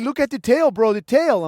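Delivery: insistent pointing out. Retold or imitated. Interpreter urging the narrator to see the cow's tail, loud and insistent. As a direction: make him look at the tail. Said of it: "He's like".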